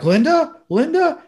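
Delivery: high, loud urgent calls; calling out. Retold or imitated. Don calling out to his wife in alarm, loud and high. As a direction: call her name loudly in alarm.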